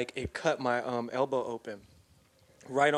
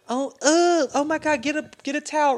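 much higher, louder alarmed mom voice; panicked mom; 11 semitones higher, 5 dB louder than usual. Retold or imitated. Mom seeing son's bleeding elbow, high panicked voice. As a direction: shriek it like a frightened mother. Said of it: "And she goes".

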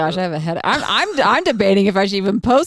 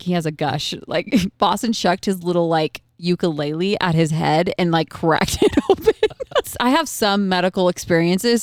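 normal talking voice

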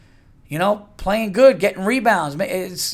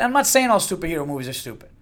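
usual speaking voice

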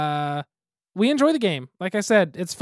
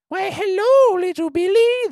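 much higher squeaky baker; 13 semitones higher, 6 dB louder, slower than usual. Shown as a demonstration. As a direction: greet sweetly as the baker. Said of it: "weird storytelling voice".

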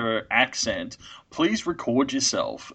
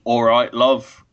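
mouthy, loud try at Yorkshire; attempted Yorkshire accent; 6 dB louder, slower than usual. Shown as a demonstration. Co-host checking what a Yorkshire accent sounds like, mock Yorkshire. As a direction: have a rough go at a curly Yorkshire accent. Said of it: "curly, mouthy".

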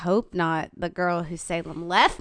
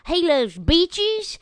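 slow, higher southern dog voice stretching out "beaches"; Cokie the dog; 9 semitones higher, 5 dB louder, much slower than usual. Retold or imitated. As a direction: drawl "beaches" slowly like a laid-back southern dog. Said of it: "And Cokie kinda talks like this".

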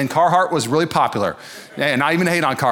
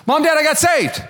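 put-on excited kid; 12 semitones higher than usual. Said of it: "tell their mom and dad".

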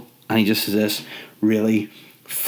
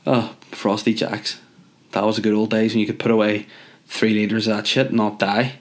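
ordinary delivery

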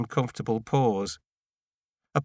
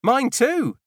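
high shout back in another voice; 13 semitones higher, 5 dB louder than usual. A narrator's reading. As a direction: fling it back across the road. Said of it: "She turns and shouts back".

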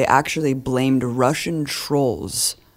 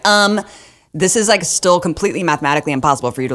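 higher, louder mocking quote; mock pedantic objection; 4 semitones higher, 4 dB louder, faster than usual. Host mimics objectors to bombing statistics, mock pedantry. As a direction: play an objector correcting someone.

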